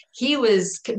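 ordinary delivery

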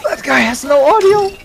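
high, loud protest; outraged shout. Real, not acted. Gamer protesting about another player's audio, outraged shout. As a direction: yell it in real disbelief. Outburst during game.

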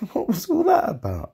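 high laughing exclamation. Real, not acted. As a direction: squeal it out with a laugh.

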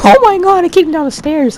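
high, loud excited shriek. Real, not acted. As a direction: shriek with delighted shock. Kicks zombie down stairs.

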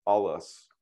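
alas with weight on the first syllable AL; teacher modelling alas, stress on AL. Shown as a demonstration. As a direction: stress AL in alas, then let it fall. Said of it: "we emphasize the first syllable".

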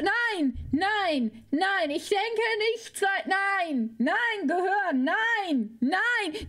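ordinary delivery

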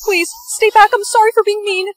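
high-pitched, panicky plea; frightened pleading. Acted. Begging someone holding a snake to stay back, frightened pleading. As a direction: beg in a high, scared voice.

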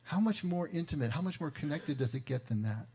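ordinary talk, nothing marked

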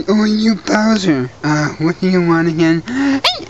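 normal talking voice

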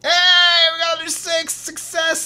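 high, jubilant cheer; triumphant cheer. Real, not acted. Celebrates a successful roll.

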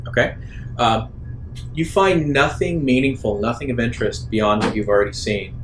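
usual speaking voice

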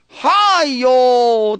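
loud Sichuan exclamation. Retold or imitated. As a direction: call out in Sichuan dialect, imitating a tourist. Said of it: "这个用四川话很大声讲啊". (用四川话大声惊呼)

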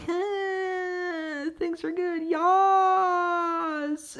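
high, soft, sing-song fake cheer; always-smiling wife. Mocking the movie wife's constant smile, high soft sing-song. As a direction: coo it out with a frozen grin. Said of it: "His wife's always smiling, like".

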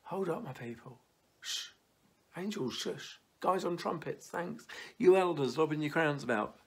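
a light fatherly shush to the angels; lightly voiced God quieting heaven to hear his child; God hushing heaven. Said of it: "going in heaven".

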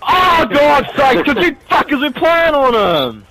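high, exasperated yell; angry outburst. Real, not acted. Player blaming teammates for playing around, angry outburst. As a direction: blame them in a frustrated shout.

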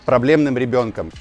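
ordinary talk, nothing marked

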